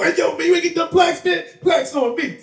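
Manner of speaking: hollering for what's owed, high-pitched; mock demanding shout. Acts out friend demanding plaques.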